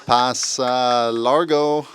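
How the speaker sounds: loud, slow Spanish match call; Spanish commentary. Acted. Commentator calls a long pass, improvised Spanish commentary. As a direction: draw out each Spanish word with volume. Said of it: "I'm trying to give Spanish and".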